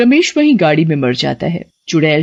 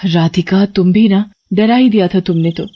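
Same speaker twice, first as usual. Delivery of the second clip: ordinary delivery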